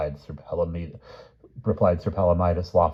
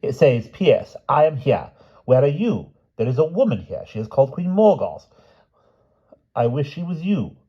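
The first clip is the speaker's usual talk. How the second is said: higher, louder earnest reading of a letter; King Pellinore reading; 9 semitones higher, 7 dB louder than usual. Acted. King reads his letter to Sir Grumor, voiced higher. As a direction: read it out like a puzzled old king.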